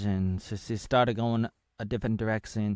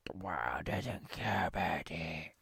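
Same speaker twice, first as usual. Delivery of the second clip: softly whispered, a touch lower; ASMR whisper; 4 semitones lower, 8 dB quieter than usual. Real, not acted. Vlogger whispering to viewers ASMR style. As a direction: breathe the words as an ASMR whisper. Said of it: "A S M R".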